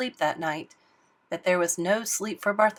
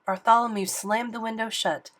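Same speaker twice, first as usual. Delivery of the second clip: usual speaking voice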